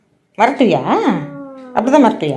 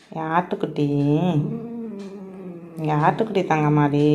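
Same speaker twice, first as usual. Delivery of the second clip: whispered-soft petting voice to a beloved animal; tender cooing; 6 semitones lower, 7 dB quieter than usual. Real, not acted. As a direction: soothe the little one quietly. Soothing her pet softly.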